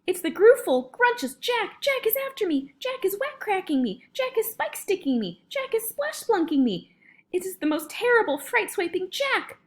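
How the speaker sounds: high-pitched giant in a nightmare; panicked bellowing. A narrator's reading. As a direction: wail in terror, pitched right up. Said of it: "bellowed the flesh lump eater".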